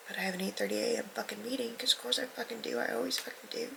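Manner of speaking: hushed irritated whisper over the meeting. Real, not acted. Vlogger griping about an early meeting, whispered. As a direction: whisper the complaint under her breath.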